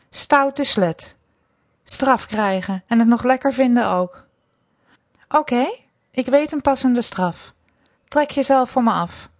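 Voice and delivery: scold, then hand down an order; stern domination. A narrator's reading. Mistress scolds and orders punishment. (streng, bestraffend bevelend)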